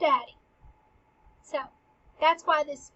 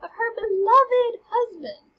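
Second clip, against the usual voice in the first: excited retelling; 8 semitones higher, 6 dB louder than usual. Real, not acted. Excited retelling of gruesome scene.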